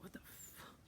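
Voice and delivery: very soft, breath-only disbelief; stunned whisper. Retold or imitated. Reacting to the being's terror and anger, stunned whisper. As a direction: whisper it, barely audible.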